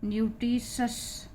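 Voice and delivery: "न्यूट्रिशस" slowly, ending in shas; measured teacher's "न्यूट्रिशस", sh in the last syllable. Shown as a demonstration. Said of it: "आई ओ का जो नियम है".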